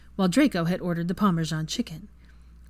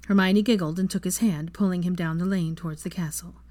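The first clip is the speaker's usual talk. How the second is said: plain reading voice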